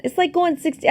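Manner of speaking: high, put-on Boston accent. Shown as a demonstration. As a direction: redo the line as a Boston tough guy. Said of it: "How is my Boston accent?".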